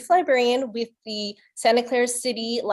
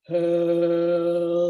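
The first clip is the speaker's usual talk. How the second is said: deep, drawn-out ox hello; as low as possible; 8 semitones lower than usual. Shown as a demonstration. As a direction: drop right down and stretch hello out. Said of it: "as low as we can".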